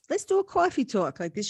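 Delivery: mock Long Island twang; Long Island accent. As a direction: imitate a Long Island friend. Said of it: "she was from Long Island".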